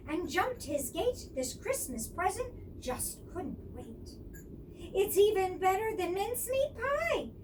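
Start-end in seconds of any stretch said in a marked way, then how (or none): 4.9-7.3 happy. Acted. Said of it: "said Missus Fox with a happy sigh".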